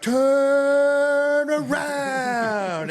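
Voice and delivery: very high, loud, drawn-out mock scream; long held scream. Mimicking a Skyfari guy yelling to riders, endlessly held scream. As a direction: stretch each word into one long high wail. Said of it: "he screams for, like, twenty-five seconds, just holding it".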